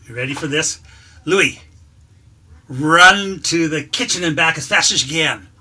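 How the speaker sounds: loud, bright trick-show announcing; loud ringmaster commanding the dog; showman announcer. Acted. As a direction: present the act to the crowd, loud.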